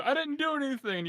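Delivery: high, fast defensive protest; high defensive whine. Acted. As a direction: blurt out an innocent denial. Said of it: "Azeroth will say".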